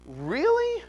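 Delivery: utter disbelief. Real, not acted. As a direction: stretch it out in total disbelief. Third, highest really.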